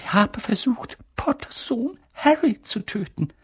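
high, slightly unsteady telling; trembling voice. Acted. Professor passing on rumours of Harry's survival, trembling. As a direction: voice quivering with held-back tears. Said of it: "mit zitternder Stimme".

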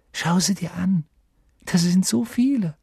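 high, thrilled wonder; eager, rising amazement; hushed excitement. Acted. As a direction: marvel in a high boyish voice. Boy thrilled by what he sees.

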